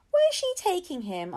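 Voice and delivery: high, loud, alarmed question; worried question. A narrator's reading. Josie asks where Ahmed is going, high and alarmed. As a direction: call out in worry. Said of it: "asked Josie".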